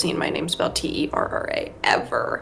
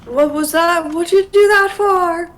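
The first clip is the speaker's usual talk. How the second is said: high, raised-pitch startled question; startled protest; 8 semitones higher, 4 dB louder than usual. Real, not acted. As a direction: demand an explanation, pitch climbing. Reacting to sudden game event.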